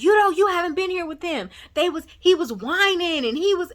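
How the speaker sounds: high, defensive protest; acted excuse-making. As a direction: imitate someone hurriedly defending himself. Acted quarrel, partner making excuses.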